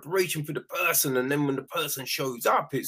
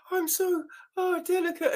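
high, fragile mock voice; prissy put-on fragility; mock delicate; 3 dB quieter than usual. Mocking a fickle character as fragile and delicate. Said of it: "when it's like".

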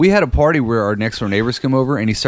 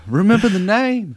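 high, hammed-up boast; mock boast; 11 semitones higher than usual. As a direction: puff it up like a drunk champion. Repeating the drunk friend's boast.

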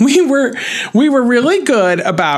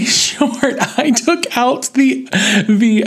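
ordinary talk

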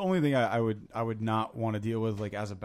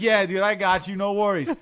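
humoring cheerfully; 7 semitones higher, 6 dB louder than usual. Retold or imitated. DJ humoring a drunk.

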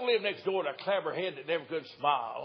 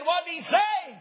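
put-on high, loud squawk of a deaf old woman; shrill old wife; 12 semitones higher, 5 dB louder, slower than usual. Deaf wife asks again.

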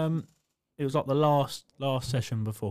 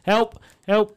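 mock plea; 7 semitones higher, 7 dB louder than usual. Retelling plea to coach while stuck.

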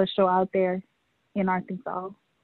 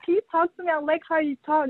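eager request; 8 semitones higher, faster than usual. Retold or imitated. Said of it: "I'm like".

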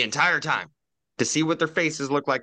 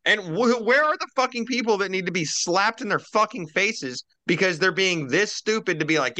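ordinary talk, nothing marked

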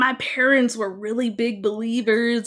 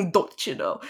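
mock Minnesotan accent; 5 semitones lower than usual. Guest playing along with host's tease, mock Minnesotan accent. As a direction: echo it back in fake Minnesotan. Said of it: "you were gonna be like".

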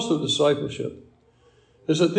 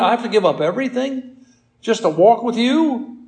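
incredulous protest; 7 semitones higher, 3 dB louder than usual. Acted. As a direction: balk at the demand, pitch climbing. Acted crowd balking at Jesus's demand.

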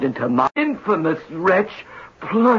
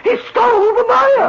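high, louder wail of the word Maya; louder, higher anguished repeat; anguished outcry; 14 semitones higher, 3 dB louder than usual. Acted. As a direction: cry out the loss in despair. Designer lamenting stolen design.

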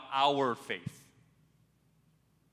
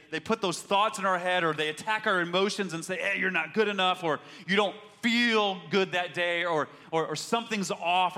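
louder, higher preaching push; full-force preaching; 4 semitones higher, 4 dB louder than usual. Acted. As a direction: drive it home from the pulpit.

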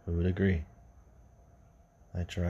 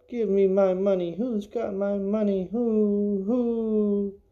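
high, loud ghost demanding her money; eerie ghost cry at its peak; ghostly wailing voice; 12 semitones higher, 3 dB louder, slower than usual. Acted. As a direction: wail like a furious dead woman. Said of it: "the woman cried".

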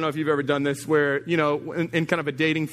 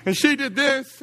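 higher, heated mocking husband; mock fuming husband; 9 semitones higher than usual. Husband pulls out phone photos in counseling, heated and higher. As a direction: play the husband with his phone evidence, higher. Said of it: "He's like".

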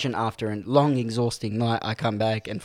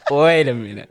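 louder, incredulous wait a minute; stunned disbelief; 6 dB louder than usual. Retold or imitated. Telling friends about the odd woman, louder in disbelief. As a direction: stop short in disbelief. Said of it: "I'm thinking to myself".

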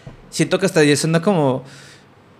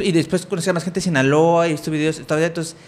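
ordinary delivery

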